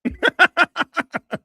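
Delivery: a high, loud cackle of laughter. Real, not acted. Streamer laughing at the clip, loud high cackle. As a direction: cackle out loud, really amused.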